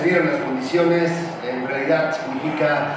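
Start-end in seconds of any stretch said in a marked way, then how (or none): none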